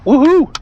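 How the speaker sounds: high, loud whoop; excited woohoo. Real, not acted. Pilot thrilled it's finally time for the Aero again, excited whoop. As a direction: let out a loud, high cheer. Cheering the airshow's return.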